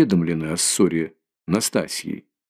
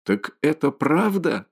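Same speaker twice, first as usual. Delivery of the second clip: higher, slower, stunned question; startled exclaim; 7 semitones higher, slower than usual. A narrator's reading. Mother learning the quarrel was real, stunned. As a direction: gasp it out in disbelief. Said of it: "вскричала".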